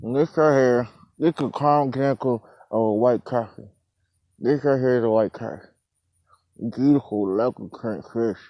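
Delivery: talking around a cigar in mouth; mumbled with a cigar in mouth. Real, not acted. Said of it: "Sorry, I had a cigar in my mouth".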